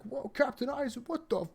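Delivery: high, hushed mock astonishment; mock shock. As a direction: gasp it out high and soft, imitating stunned onlookers. Voicing onlookers finding the body.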